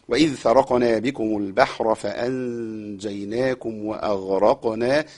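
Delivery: slow, measured chanting of the verse; Quran recitation. Shown as a demonstration. Teacher recites the verse for students, slow chant. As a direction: recite it calmly with long vowels.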